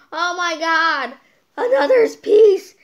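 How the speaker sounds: high, loud alarmed shout; panicked astronaut. Pretend. Imagined spaceship crew spotting space junk, high loud alarmed yell. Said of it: "then say".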